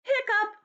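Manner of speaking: high sharp hiccup sound. Acted. Storyteller voicing elephant's hiccup, high and sudden.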